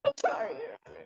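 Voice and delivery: real upset apology, starting small and high; emotional. Real, not acted. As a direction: voice climbing high and soft, then recovering. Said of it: "Like, I'm emotional".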